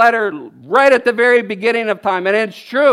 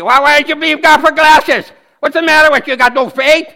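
mock accuser; 4 semitones higher, 4 dB louder than usual. Mocking critics of his glasses.

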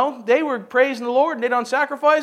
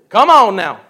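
usual speaking voice

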